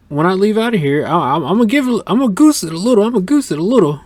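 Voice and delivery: put-on an excited old man bragging about his car; excited Cecil. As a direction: pump it up like a proud old car guy. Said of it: "he was like".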